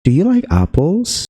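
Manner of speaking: yes-no question, voice lifting on "apples"; rising question intonation. Shown as a demonstration. Teacher demonstrating a yes-no question to learners, rising intonation. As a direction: pitch climbing high to "apples". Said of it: "menggunakan intonasi naik ini".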